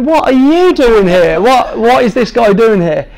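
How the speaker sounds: high, loud, slowed startled cry; shocked shout. Retold or imitated. Patient yelling at the cleaner who walked in. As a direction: yell in disbelief at the intruder.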